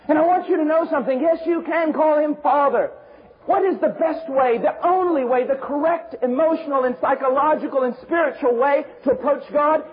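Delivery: high, insistent preaching voice; forceful preaching. Real, not acted. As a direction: press the point from the pulpit.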